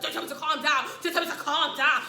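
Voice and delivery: high, heated retort; imitating someone losing his cool; loud, refusing to calm down. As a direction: throw it back loudly. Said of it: "Can you calm down".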